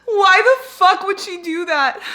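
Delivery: high, loud, drawn-out protest; anguished outcry. Real, not acted. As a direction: wail the question in disbelief. Upset at author killing character.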